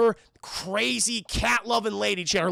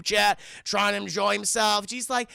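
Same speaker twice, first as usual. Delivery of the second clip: ordinary talk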